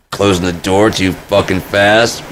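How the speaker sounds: normal talking voice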